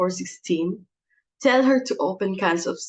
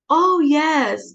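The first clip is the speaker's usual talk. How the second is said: gushing greeting; 7 semitones higher, 7 dB louder than usual. Acted. Sudden gush on the phone.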